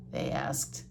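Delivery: usual speaking voice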